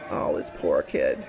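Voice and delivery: pitying aside. Real, not acted. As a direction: whisper it to yourself.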